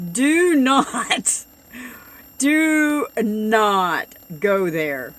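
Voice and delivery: loud, slow, every word hammered; a real loud warning, dragged out; emphatic warning. Real, not acted. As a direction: warn the people off, slowly and loudly. Warning viewers off the shop.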